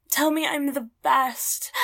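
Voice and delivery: loud pleading demand. Acted. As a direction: call it out, breathless. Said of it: "repeated, loud then whispered".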